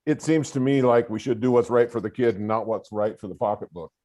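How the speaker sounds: ordinary delivery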